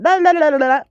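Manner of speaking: mock mindless chatter at a dog; mock singsong chatter. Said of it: "I don't wanna be going".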